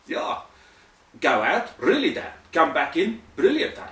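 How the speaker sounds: loud, emphatic mock South African; South African accent. South African boss explains fake firing, emphatic South African accent. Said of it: "as South Africans".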